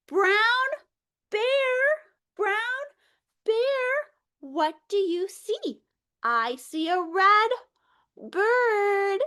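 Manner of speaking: slow sing-song picture book reading; reading to children. Acted. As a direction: read it aloud to a class of small kids. Reads picture book to kids.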